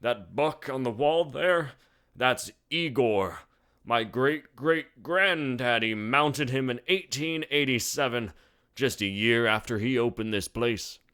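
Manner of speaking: put-on proud old bartender boasting; old barkeep. A narrator's reading. Gainsborough voice.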